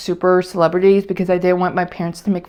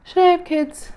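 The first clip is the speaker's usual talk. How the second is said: higher, softer and more distant, asked again; repeating it to herself, drifting and high; dreamy echo; 10 semitones higher, 5 dB quieter than usual. Retold or imitated. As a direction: echo the question as if far away. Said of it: "where I'm like".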